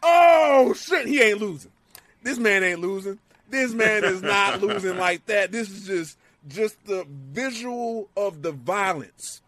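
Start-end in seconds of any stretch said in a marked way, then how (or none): 0.0-5.5 loud, high, amazed outburst that settles down; excited disbelief. Retold or imitated. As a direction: blurt it like you can't believe it. Retells his own excitement.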